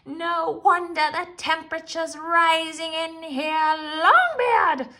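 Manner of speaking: high, loud witch outburst; furious shriek. A narrator's reading. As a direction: explode in rage. Witch bursts out in rage.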